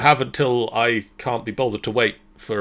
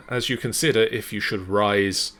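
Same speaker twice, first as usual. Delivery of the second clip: ordinary talk, nothing marked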